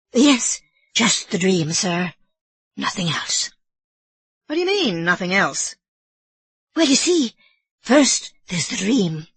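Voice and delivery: put-on old Irish housekeeper explaining herself nervously; Mrs Leahy.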